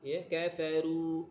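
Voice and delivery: "kafaru" slowed, the ru heavy; mock wrong "kafaru"; wrong form. Shown as a demonstration. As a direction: drawl "kafaru" as the wrong reading. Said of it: "tidak ada kafaru".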